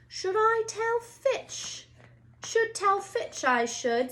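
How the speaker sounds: high, slow, falsely innocent poltergeist; saintly voice. A narrator's reading. Peeves pretending innocence to the students, high saintly voice. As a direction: sound sweet and holy while scheming. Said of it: "said Peeves in a saintly voice".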